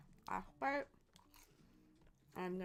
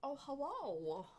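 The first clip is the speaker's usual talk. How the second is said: sing-song greeting; 6 semitones higher, slower than usual. Real, not acted. Slow high far greeting.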